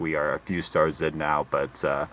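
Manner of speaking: low, calm airline pilot announcement; steady captain over the intercom; pilot cockpit headset. Pretend. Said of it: "do, like, the safety protocol".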